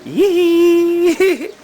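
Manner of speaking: long high-pitched "yee"; excited squeal. Real, not acted. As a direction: cheer with a drawn-out squeal. Cheering the child on.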